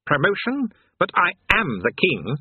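high, bewildered demon king; weak protest. A narrator's reading. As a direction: protest feebly, starting high in disbelief. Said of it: "Astfagel protested weakly".

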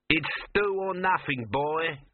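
leering cook, drawn out slow. Acted. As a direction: leer at the boy as a greasy cook. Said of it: "the cook leered".

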